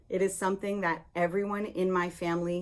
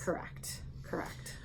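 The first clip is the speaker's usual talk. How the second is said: whispering; 11 dB quieter than usual. Real, not acted. Whispered agreement.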